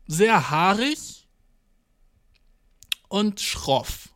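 very slow, stretched words; drawn-out description. Real, not acted. Describing the doctor's hairy toe look, very slow. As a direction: savor each word slowly.

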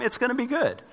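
to his family on a jammed highway, a lilting high good; high sing-song reassurance. Retold or imitated. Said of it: "I'm like".

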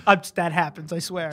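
normal talking voice

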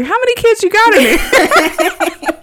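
high, slow cartoon voice breaking into laughter; host voices Mike through laughter, high and slow; Mike, laughing. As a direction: squeak it out through giggles. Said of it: "And he's like".

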